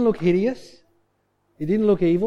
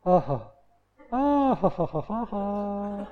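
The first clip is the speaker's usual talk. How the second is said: imitated sleep laugh; 3 semitones higher, slower than usual. Husband replaying wife's night-time laughing fit, imitated laugh. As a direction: mimic helpless laughter bursting out and trailing off. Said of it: "she'd go".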